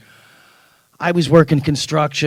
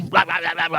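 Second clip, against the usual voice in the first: mock angry reading; 5 semitones higher, faster than usual. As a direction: rattle off angry nonsense syllables, pitched higher. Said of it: "like, all angry".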